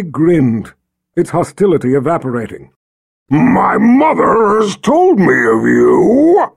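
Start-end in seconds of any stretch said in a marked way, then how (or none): 3.3-6.5 loud, slow booming creature; dragon voice. Acted. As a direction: rumble it warmly like a beast. Dragon speaks to Richard.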